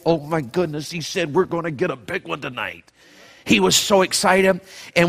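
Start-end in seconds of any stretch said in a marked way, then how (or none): 0.0-2.8 hushed excitement. Retold or imitated. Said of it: "my papa got so excited".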